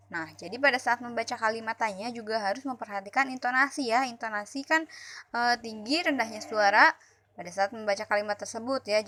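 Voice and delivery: ordinary talk, nothing marked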